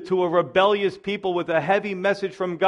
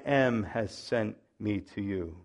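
solemn voice of God; 7 semitones lower, 6 dB quieter, slower than usual. Acted. Reading God's words solemnly.

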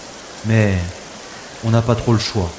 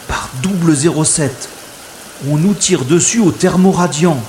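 louder, rising alarm under fire; alarmed shout; 6 semitones higher, 3 dB louder than usual. Acted. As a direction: cry out as the shots hit the water. Shot at on the river.

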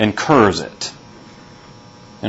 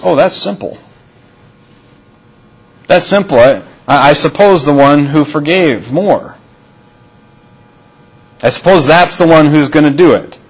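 sarcastic; 4 semitones higher than usual. Retold or imitated.

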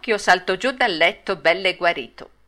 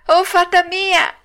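higher, louder drawn-out wail; panicked shriek; 12 semitones higher, 6 dB louder, slower than usual. A narrator's reading. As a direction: shriek for rescue as a terrified puppet. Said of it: "cominciò allora a strillare il burattino".

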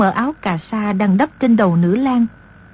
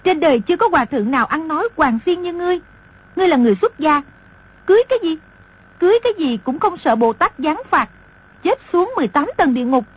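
higher, fast indignant scolding; angry; 6 semitones higher, faster than usual. A narrator's reading. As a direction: scold the shameless monk. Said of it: "tức giận nói".

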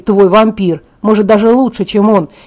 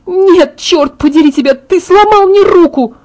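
high, pained, angry yelling; high furious cry, slowing on the final accusation; furious outburst; 9 semitones higher, 4 dB louder than usual. Acted. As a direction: rage at him for your broken hand. Hurt heroine rages at Jacob.

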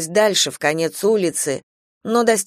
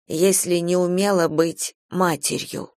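ordinary delivery, nothing marked

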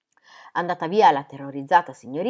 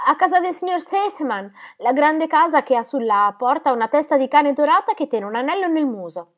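put-on high-pitched little girl's voice; eager little Heidi, explaining; 8 semitones higher, 4 dB louder than usual. Heidi giving the caretaker her address, high and eager. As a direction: explain brightly in a high child's voice.

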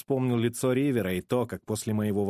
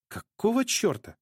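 shocked; 10 semitones higher than usual. A narrator's reading. Evelyn shocked by singing.

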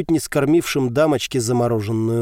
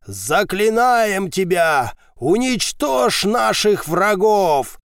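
ritual incantation; 6 semitones higher, much slower than usual. A narrator's reading. Priest's ritual invocation.

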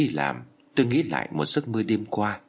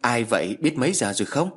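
called out; 3 semitones higher than usual. A narrator's reading. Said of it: "Tôi hỏi vọng ra".

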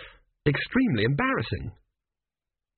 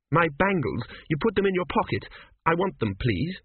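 higher-pitched, insistent heroine; insistent woman character; 6 semitones higher than usual. A narrator's reading. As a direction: demand it back in a raised-pitch character voice. Voices Petracci demanding bangles back.

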